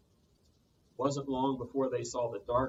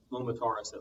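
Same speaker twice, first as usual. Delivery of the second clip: plain reading voice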